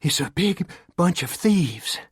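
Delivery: out of breath, forcing the words out between gulps of air; gasped out after a hard run; gasping. A narrator's reading. Girl gasping out news after running to river. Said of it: "Neva gasped finally".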